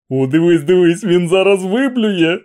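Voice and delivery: a spectator thrilled by the show; excited cry from the crowd; gleeful shout. Acted. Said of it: "У задоволеному екстазі вигукнув хтось".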